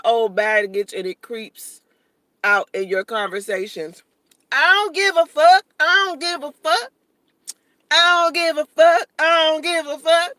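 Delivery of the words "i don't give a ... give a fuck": high, emphatic brush-off; real defiant. Real, not acted. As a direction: repeat it high and punchy, not caring. Defiant reply to viewer's baggage comment.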